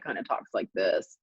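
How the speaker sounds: low, flat surfer-guy drone; monotone imitation. Describing the laid-back CEO, mock low monotone. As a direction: sink the pitch and let it lie flat. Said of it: "not a lot of undulation to his voice".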